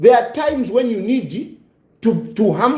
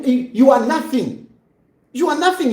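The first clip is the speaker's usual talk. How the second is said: ordinary delivery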